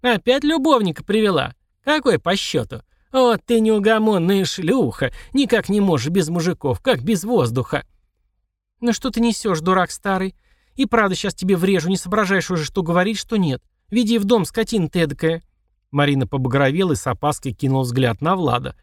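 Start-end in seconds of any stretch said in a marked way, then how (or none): 0.0-7.8 put-on old prospector. Old servant voiced. 8.8-15.4 angry scolding. Acted. Marina scolding servant.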